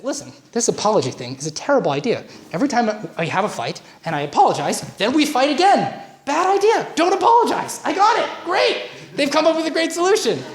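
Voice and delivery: hammed-up exasperated man swearing off apologies; mock frustrated husband. Coach mimicking a fed-up husband, rising to a high, loud rant. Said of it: "And so he says".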